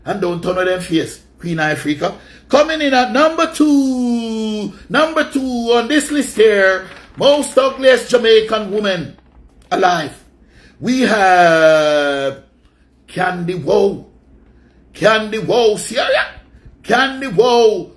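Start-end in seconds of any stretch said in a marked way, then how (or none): 2.5-14.1 drawn-out louder host voice counting down the list; countdown announcer. Acted. Host announcing the next entry on his list, slow and booming. 14.9-17.9 calling out name. Acted.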